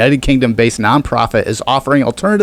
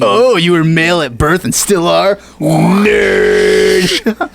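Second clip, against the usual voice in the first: mock bully, yelling "Nerd!" at the end; eighties movie bully; 5 semitones higher, much slower than usual. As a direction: sneer it like a jock in an eighties movie. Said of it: "bullies from the fucking eighties".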